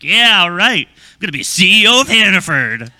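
put-on cocky young self. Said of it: "So I was like".

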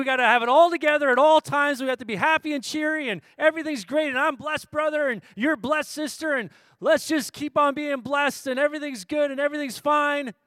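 preacher mocking forced cheerfulness; mock cheery churchgoer. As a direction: parody the always-blessed believer.

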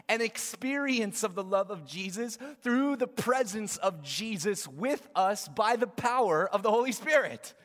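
loud, high, emphatic preaching. Real, not acted. Preacher urging the congregation toward the Holy Spirit, preaching loud. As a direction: preach it with full force, hitting key words.

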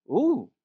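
high, swooping "ooh"; mock "ooh". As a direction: react with a high, teasing ooh. Mock reaction to promised meat.

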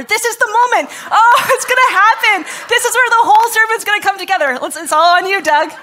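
high, loud, laughing hype; giddy shout through laughter; excited joking. Real, not acted. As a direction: burst out in gleeful mock anticipation. Joking that water becomes wine live.

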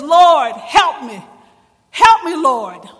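loud desperate plea for help; crying out. Acted. Kneeling mother cries out to Jesus, loud desperate plea. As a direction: cry it out, begging with everything. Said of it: "she began to cry out".

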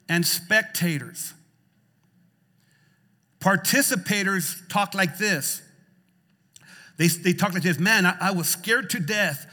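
loud, punchy preacher delivery; impassioned preaching. Acted. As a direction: drive each phrase home to the congregation. Preaches at full force.

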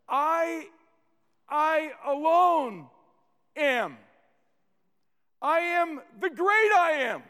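"I" loud, high and set apart by pauses; emphatic I. Shown as a demonstration. Preacher voices Jesus claiming the title, forceful stress on "I". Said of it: "emphasis on the word "I".

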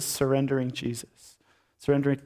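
ordinary talk, nothing marked

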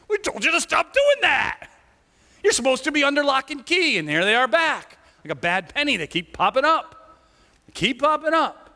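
high, whiny complaining official; whiny high priest. Acted. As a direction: whine like an irritated priest.

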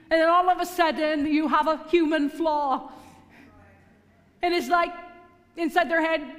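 loud, high, driving sermon build; raising the voice to stir the congregation; preaching at full force. Acted. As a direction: preach it up to the rafters.

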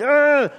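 frustrated groan. Retold or imitated. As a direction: let out a fed-up groan. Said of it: "be frustrated".

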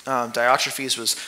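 ordinary talk, nothing marked

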